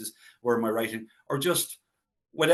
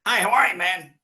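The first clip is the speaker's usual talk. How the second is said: high, loud, chirpy greeting; a star greeting his bodyguard, done high and bright; 7 semitones higher, 5 dB louder than usual. Retold or imitated. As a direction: toss off a breezy star's hello, pitched up. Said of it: "and he goes".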